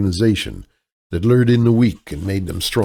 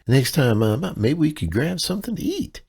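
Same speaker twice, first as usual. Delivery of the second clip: higher, helpless Midwestern damsel voice; damsel in distress; 8 semitones higher than usual. Acted. Kaylee sweet-talks the clerk as a damsel in distress. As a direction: play the sweet, lost out-of-towner, pitched up. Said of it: "her damsel in distress persona".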